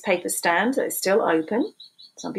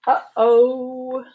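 high, sing-song uh-oh; a cartoonish oops for the kids; playful uh-oh; 4 semitones higher, 4 dB louder than usual. Refrain voiced by book character.

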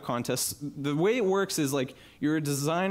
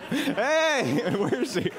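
laughing greeting; 9 semitones higher, much slower than usual. Real, not acted. Speaker spotting his wife in the crowd, laughing. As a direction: call out to her through laughter.

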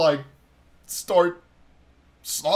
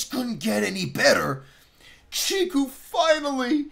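much higher, drawn-out delighted squeal; overjoyed squeal; 10 semitones higher, slower than usual. Real, not acted. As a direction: squeal with pure joy, stretching the words. Thrilled that Chiku gets a bath.

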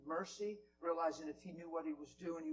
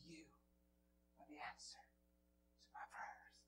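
lower, softer whisper, slow; whispered confession; 4 semitones lower, 19 dB quieter, slower than usual. Retold or imitated. HR lady tells preacher he answered her prayers, whispered. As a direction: breathe it out slowly to him. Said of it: "looked at me right in the eyes and said".

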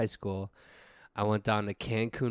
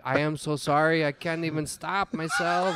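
put-on higher, comic Mexican accent; 4 semitones higher than usual. Drunk tourist apologizing to his annoyed buddy, mock Mexican accent. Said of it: "if you don't stop talking like that".

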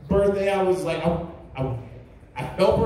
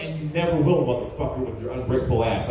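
retold wife's retort; 9 semitones lower, faster than usual.

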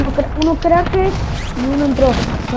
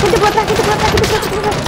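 higher, urgent repeated warning; urgent callout; 7 semitones higher, faster than usual. Real, not acted. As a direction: shout the warning to teammates. Warning teammates of enemies behind.

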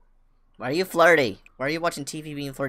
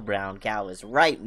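ordinary delivery

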